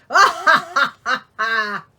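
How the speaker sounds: loud gloating villain laugh; booming storybook villain laugh; evil queen cackle. Acted. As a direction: cackle in triumph like the wicked queen. Wicked queen gloats over victim.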